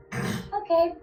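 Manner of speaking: forced sweet reply. Real, not acted. Forced reply to crying boyfriend.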